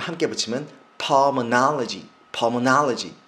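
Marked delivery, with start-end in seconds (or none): 1.0-2.1 "pulmonology" slow, with a loud "NAH"; stressed "ah" sound. Shown as a demonstration. Teacher joining root and suffix, stressed "ah". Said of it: "아로 발음하시면 됩니다". 2.3-3.1 "pulmonology" slowly, loud on "NAH"; stressed "ah" sound. Shown as a demonstration. Teacher drilling the term for students, stressed "ah". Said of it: "아로 발음하시면 됩니다".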